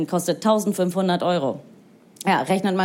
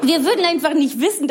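ordinary talk

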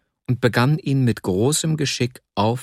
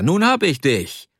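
höher, auf hab betont, fröhlich triumphierend; höher gerufen, das Wort hab betont; 6 semitones higher than usual. Acted. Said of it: "rief er". (triumphierender Ruf, höher, Betonung auf hab)

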